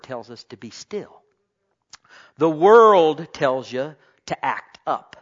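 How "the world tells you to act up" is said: slow loud refrain. Acted. Preaching refrain, repeated.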